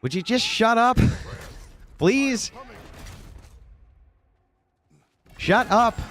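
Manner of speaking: exasperated mock retort at the interrupter; mock shout. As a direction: yell her down in a high pitch. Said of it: "It's like".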